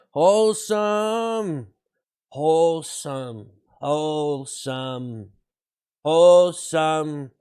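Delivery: very slow, savoured word; slow, drawn-out catchword. Pretend. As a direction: stretch both syllables right out. Chanting the stream's catchword slowly.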